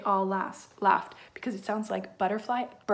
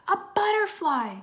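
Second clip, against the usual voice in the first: high, loud cry of discovery; excited shout; 10 semitones higher, 6 dB louder than usual. A narrator's reading. Mina discovers what is in the third aquarium, excited shout. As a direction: call it out with delight. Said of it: "Mina shouted".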